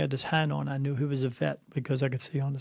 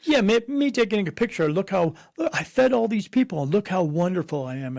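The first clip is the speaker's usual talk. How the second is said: higher, louder smug bragging voice; mocking show-off; 5 semitones higher, 4 dB louder than usual. Mocking charity show-offs who crave applause, higher and smug. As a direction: lampoon a do-gooder fishing for praise. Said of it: "they think purpose is me".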